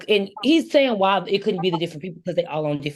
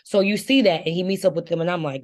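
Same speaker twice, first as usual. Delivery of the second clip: normal talking voice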